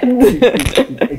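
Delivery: high, slow, amused echo; playful surprise. Real, not acted. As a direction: draw it out in teasing amazement. Playful echo of her remark.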